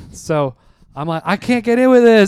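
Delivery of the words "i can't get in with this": high, loud retold outburst; exasperated. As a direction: re-enact yelling at the landlord in frustration. Retold protest to landlord, locked out.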